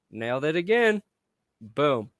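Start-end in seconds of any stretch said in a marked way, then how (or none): none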